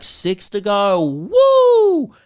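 slow build into a high, loud woo; gleeful rising cheer, ending in a held whoop; triumphant whoop. Retold or imitated. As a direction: celebrate like scoring a victory. Boasting of crushing her, celebratory whoop.

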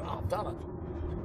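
breathy near-whisper to yourself; hushed inner thought. Retold or imitated. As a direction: mutter it quietly as a thought. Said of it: "I thought".

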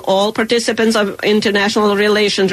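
ordinary talk

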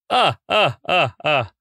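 cartoon vampire "ah, ah, ah, ah" laugh; staccato counting-vampire laugh, starting high and falling; Count Von Count laugh. Shown as a demonstration. Puppeteer shows off the Dracula parody, staccato cartoon laugh. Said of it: "Count Von Count".

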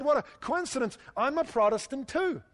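high-pitched, eager discovery of common ground; mock delighted surprise. Rescuer to man on the bridge in a joke, mock delighted.

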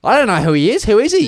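rising into a high puzzled mum voice; mum's shrug. Retold or imitated. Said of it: "And mum's just like".

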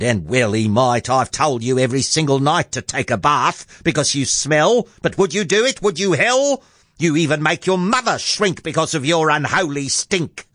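indignant nagging, rising high; scolding mother. Acted. Mother nagging Jack to take a bath, scolding mother. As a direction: tell the boy off with climbing exasperation.

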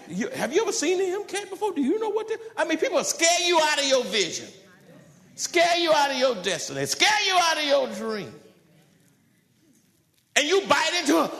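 high, loud, driving preacher's cry; rising to a fiery sermon climax; preaching at full force. Acted. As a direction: lift the congregation with a fiery pulpit peak.

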